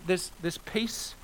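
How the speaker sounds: usual speaking voice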